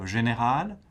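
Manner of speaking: GÉNÉRAL swept up at the end; rising intonation. Shown as a demonstration. Teacher pointing out the tune, GÉNÉRAL rising. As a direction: lift the last syllable of GÉNÉRAL. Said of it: "goes up at the end".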